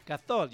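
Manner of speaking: "Gasol" with the s turned into TH; Castilian TH sound. Shown as a demonstration. Teaching a jealous friend the accent, "Gasol" with a TH. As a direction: say "Gasol" with a Spanish lisped s. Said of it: "You just say a T H sound".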